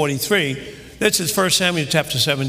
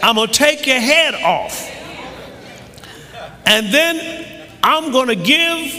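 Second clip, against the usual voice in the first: preaching; 6 semitones higher, slower than usual. Acted. Pastor voicing David's challenge to the Philistine, building to full-force preaching. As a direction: drive David's threat home like a fiery sermon. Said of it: "Boy, I'm preaching".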